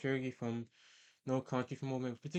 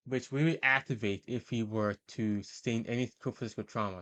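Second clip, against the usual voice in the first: normal talking voice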